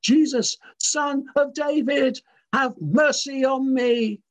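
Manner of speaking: high, slow, drawn-out cry for mercy; shouting out. A narrator's reading. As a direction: call out to Jesus over the crowd. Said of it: "and he shouts out".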